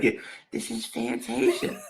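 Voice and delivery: put-on high, soft baby-like voice. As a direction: play her sweet tiny voice. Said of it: "she got that little accent".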